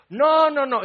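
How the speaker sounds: high, loud insistent no; emphatic denial. Retold or imitated. Peter protesting to Jesus, loud insistent denial.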